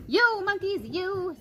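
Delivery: high, scolding voice; scolding peddler. A narrator's reading. Peddler scolding the monkeys in a high scolding voice. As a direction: wag a finger and scold them. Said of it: "shaking a finger at them".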